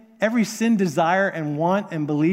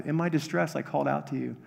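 quiet prayer; 3 semitones lower, 7 dB quieter than usual. Retold or imitated. Quoting the psalm prayerfully.

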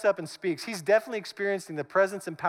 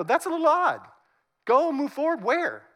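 pitched-up disbelief; incredulous protest; 6 semitones higher than usual. Acted. Israelites balking at the order to move forward, incredulous. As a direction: object as if the order is absurd. People baffled by God's order.

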